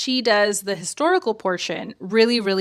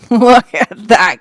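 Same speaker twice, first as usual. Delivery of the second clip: "Look at that," burst out much louder through a laugh; 2 semitones higher, 13 dB louder, slower than usual. Real, not acted. Amused by what's on screen, laughing loudly at viewers. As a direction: blurt it out laughing.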